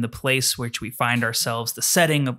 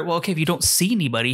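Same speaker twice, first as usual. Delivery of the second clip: usual speaking voice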